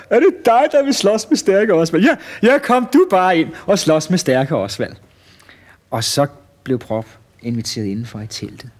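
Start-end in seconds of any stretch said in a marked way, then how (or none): 0.0-4.9 high, loud mocking mirth; dying of laughter. Showman mocking the little fat challenger, loud high laughing jeer. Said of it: "han var ved at dø af grin".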